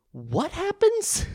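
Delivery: high, slow, drawn-out question; high mock question. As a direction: stretch it up high in mock wonder.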